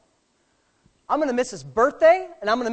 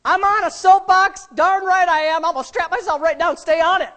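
loud, high soapbox preaching; full-force preaching; 8 semitones higher, 7 dB louder than usual. Real, not acted. Pastor defiantly staying on his soapbox, full-force preaching. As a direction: plant yourself and preach it at full volume.

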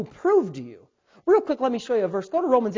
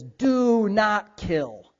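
very slow, weighty delivery; solemn commandment; much slower than usual. Acted. Pastor quoting God's commandment, slow and solemn. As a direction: draw out each word as a decree.

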